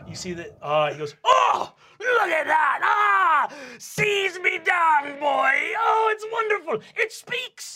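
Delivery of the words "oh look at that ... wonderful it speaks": loud, high, theatrical delight; hammy stage actor. Acted. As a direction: ham it up like the stage. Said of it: "hammy stage actor".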